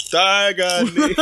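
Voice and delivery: high, drawn-out battle cry; game catchphrase call. Acted. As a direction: stretch it out like a fighting-game special move. Redoes a game character's catchphrase.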